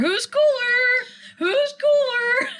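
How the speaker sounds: squealed way up high, slow and laughing; drawn-out giddy squeal; high teasing squeal. Real, not acted. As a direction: tease her in a giddy squeak. Teasing squeal about crush.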